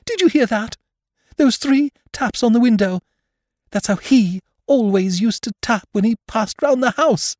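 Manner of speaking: high, urgent frightened woman's voice; woman on edge, pitch held high. Acted. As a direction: gasp it out in high-pitched alarm. Frightened woman alarmed by tapping.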